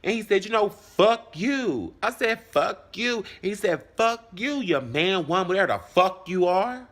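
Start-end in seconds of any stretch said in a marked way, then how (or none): 0.4-1.9 high, slow, drawn-out curse; retold insult thrown back. Acting out his insult back. 2.5-3.2 retold angry comeback. Acting out the quarrel. 4.0-6.9 high-pitched, rising imitation of his jab; retold scornful insult.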